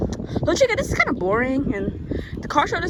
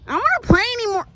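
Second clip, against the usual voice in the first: whiny complaint; 9 semitones higher, 4 dB louder, much faster than usual. Pretend. Whiny quit in game.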